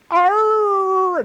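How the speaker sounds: high, wailing imitated howl, held out; eerie howl. Telling friends about the UFO night, imitating the eerie high wail. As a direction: mimic an unearthly droning wail.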